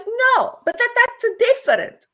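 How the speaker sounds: mock high protest; imitated protest. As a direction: imitate an indignant mum, voice jumping way up. Retells mum objecting to her.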